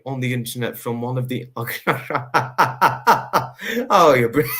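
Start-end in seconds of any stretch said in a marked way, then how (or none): 1.5-3.8 high, bright burst of laughter. Real, not acted. Laughing at Anna's comment. 3.9-4.6 words squeezed out through laughter, louder; laughing. Real, not acted. Laughing at Anna's comment.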